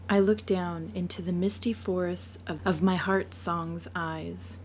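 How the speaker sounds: ordinary delivery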